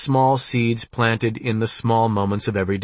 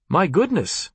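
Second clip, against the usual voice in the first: put-on higher, surprised Indian-accented voice; gentle Indian accent; 6 semitones higher than usual. A narrator's reading. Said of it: "a gentle Indian accent".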